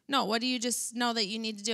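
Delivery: ordinary talk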